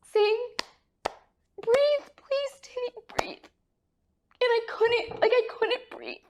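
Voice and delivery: very high, choked-up voice; tearful. Real, not acted. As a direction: voice climbing as tears take over. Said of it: "I'm starting to get emotional".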